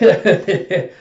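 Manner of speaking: high, loud hidden-watcher snicker; mock snicker. Watcher in the bushes gloats at a flop, snickering. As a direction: snicker at someone else's failure.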